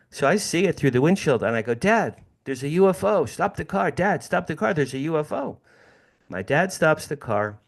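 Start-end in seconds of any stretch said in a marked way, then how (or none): 1.7-5.6 put-on excited kid. Kid spotting UFO, calling dad.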